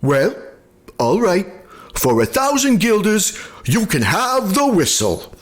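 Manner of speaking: Jan giving in. A narrator's reading. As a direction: Jan pretending to relent. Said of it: "Jan agreeing".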